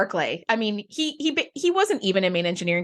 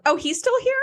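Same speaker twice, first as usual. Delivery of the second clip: laughing. Real, not acted. Laughing at Reg's return.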